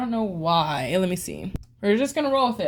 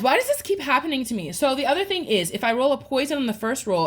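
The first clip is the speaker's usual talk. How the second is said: ordinary talk, nothing marked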